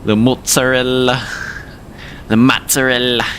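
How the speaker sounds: imitated Italian New Yorker saying mozzarella; New York Italian accent. Streamer imitating TikTok kid, New York Italian accent. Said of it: "he says it in the New York accent with the Italian accent".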